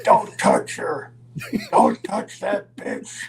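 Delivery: slow, loud, breathy and low "Don't touch her"; angry warning. Retold or imitated. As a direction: growl it down the stairs at him. Roddy yells from the stairs.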